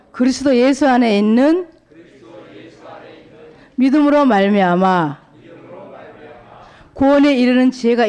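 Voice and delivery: slow, loud, measured Bible reading; 성경 봉독하듯 천천히 크게; solemn scripture reading. Acted. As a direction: read each phrase with long weighty pauses. Reads scripture aloud.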